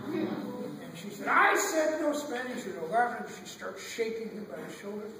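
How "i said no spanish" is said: high, loud, slow order; mock screaming teacher. Teacher repeating her ban to Ramón, mock scream. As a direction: hammer it out like an enraged teacher. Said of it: "she starts screaming".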